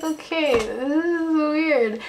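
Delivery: high, drawn-out uneasy aside; retold awkward squeak; awkward reaction. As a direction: stretch it out high, as if weirded out.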